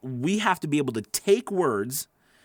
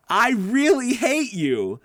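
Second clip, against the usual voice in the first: high, slow mock "I really hate you"; an exaggerated, playful declaration of hate; fake sarcastic hate; 8 semitones higher, 5 dB louder, slower than usual. Shown as a demonstration. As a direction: say it loud and high like a joke. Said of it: "that doesn't make sense".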